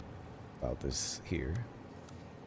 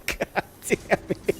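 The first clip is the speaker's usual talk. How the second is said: laughing curse; 11 semitones higher, slower than usual. Real, not acted. Player laughing at the ruined build, cursing through giggles.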